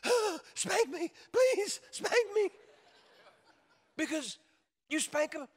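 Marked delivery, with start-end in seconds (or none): 0.0-2.5 high, loud, slow hammed-up pleading; imitated child's desperate squealing plea; mock frantic child. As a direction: beg like a frantic little kid. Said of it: "where they're just frantic".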